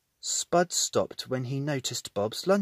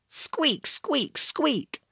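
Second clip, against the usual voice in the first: squeaky mouse squeaks; 12 semitones higher, much slower than usual. A narrator's reading. Boots squeaking.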